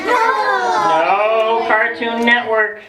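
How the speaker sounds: slow, drawn-out teasing build; slow mock-solemn countdown; dramatic slow list. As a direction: stretch each item out to get a No from the kids. Teasing kids about Antarctica.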